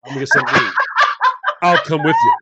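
put-on loud, chummy boy voices; hammed-up eager teenage boys. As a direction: call your buddies to the fridge. Boys voiced heading to fridge.

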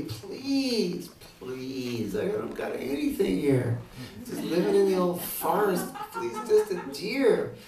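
high, loud begging plea; high pleading woman's voice; pleading Sita. Acted. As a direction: beg him like a spoiled wife.

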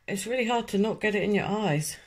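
lips stiff under wet mask; talking while smoothing mask; through a sheet face mask. Real, not acted. Seen 0: Wet sheet mask covers face, clings around lips while she talks.

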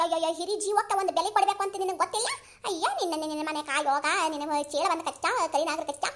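loud, lamenting scolding full of ayyo; angry granny's wailing curses. Acted. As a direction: curse and wail like an offended elder. Granny keeps cursing and wailing.

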